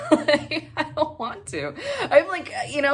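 high laugh through the words. Real, not acted. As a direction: giggle as you confess. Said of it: "laughing at herself".

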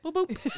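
high, light double boop; playful bump sound effect; imitated bump. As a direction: pop out two little curb bumps. It was like.

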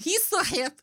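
high-pitched mock German accent. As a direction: try a silly German accent. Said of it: "like, a German accent".

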